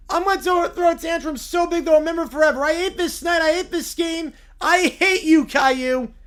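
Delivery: put-on a sulking kid about to lose it; upset whiny bear. Bear tantrum.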